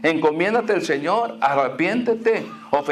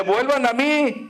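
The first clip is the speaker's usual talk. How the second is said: high, calling plea; prophet's cry; 8 semitones higher than usual. Acted. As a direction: call them back like a prophet. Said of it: "está gritando el profeta". (el profeta clamando)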